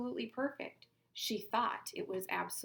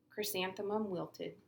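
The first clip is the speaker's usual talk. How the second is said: ordinary delivery, nothing marked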